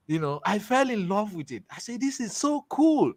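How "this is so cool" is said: higher, awed and delighted; amazed delight. Retold or imitated. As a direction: gush like you just fell in love with it. Retelling amazement at the truck.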